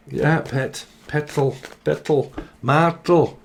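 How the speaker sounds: imitating a northern English grandma; lightly voiced northern grandma endearments; a hint of grandma's northern accent. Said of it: "she used to talk like that".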